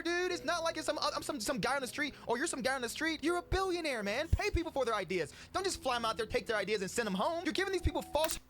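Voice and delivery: heated, incredulous rant at a billionaire; worked up. Real, not acted. Vlogger calling out a billionaire for taking his idea, worked-up and high. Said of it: "I'm actually getting worked up right now".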